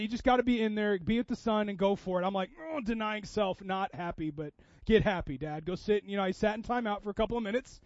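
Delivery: ordinary talk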